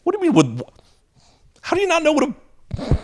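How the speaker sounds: can't believe what you hear; teacher stunned by students' question; incredulous teacher. Retold or imitated. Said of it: "I'm like".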